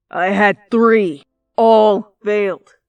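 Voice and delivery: loud, slow, fed-up venting; frustrated outburst. Acted. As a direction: bite out each word, slowly and loud. Katsuki venting his failed plans.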